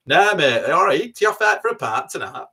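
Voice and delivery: put-on broad Wigan accent, high and loud. Telling of strangers on the bus, in a broad Wigan accent. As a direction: imitate people on the bus in thick Wigan. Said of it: "that was very Wigan".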